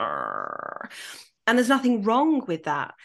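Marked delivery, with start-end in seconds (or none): 0.0-0.9 very low, drawn-out groan; long low creaky groan; mock dismayed groan. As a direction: groan deep in the throat. Mock dismay at accent remark.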